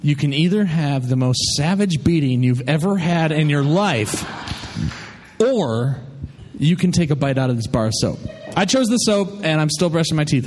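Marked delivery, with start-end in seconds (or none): 0.0-8.2 low, stern dad impersonation; mock-menacing low fatherly threat; imitating dad. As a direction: lay down the ultimatum like dad, pitched low. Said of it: "he said".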